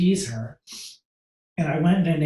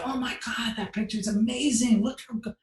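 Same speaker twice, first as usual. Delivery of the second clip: higher, airy, over-eager praise; forced gushing; 6 semitones higher than usual. Retold or imitated. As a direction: gush politely while hiding disappointment. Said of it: "I'm trying to compliment all the photos".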